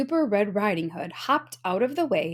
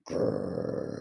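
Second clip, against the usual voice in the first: very low rumbly growl; deep beastly snarl; menacing growl; 8 dB quieter than usual. A narrator's reading. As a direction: growl like a huge wolf. Wolf's terrifying growl.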